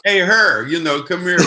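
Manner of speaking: casual, joking shout-out; mock calling out. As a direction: pretend to holler over at her. Jokingly acts out calling her.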